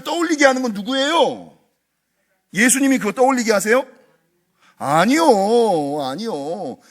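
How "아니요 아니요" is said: ordinary talk, nothing marked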